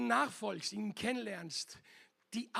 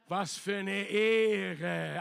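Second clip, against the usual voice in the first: louder and slow, savouring each word; emphatic praise; 8 dB louder, much slower than usual. Real, not acted. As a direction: proclaim the honour slowly. Moved thanks for the honour.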